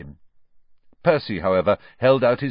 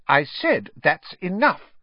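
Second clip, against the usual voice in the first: high, louder, slow telling-off; exasperated scolding; 9 semitones higher, 5 dB louder than usual. A narrator's reading. Mother stopping her twins for the second time, exasperated scolding. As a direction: lay down the law to the twins.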